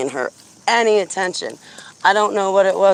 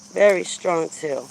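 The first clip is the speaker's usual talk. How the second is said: normal talking voice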